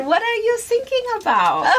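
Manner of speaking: put-on high, laughing German accent as told to the baby; high playful German accent through a laugh; mock German accent. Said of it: "that's what we say to her".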